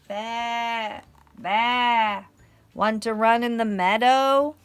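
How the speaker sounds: loud bleating sheep voice; bleat, then call out the invitation; big, playful sheep call; storybook sheep. Acted.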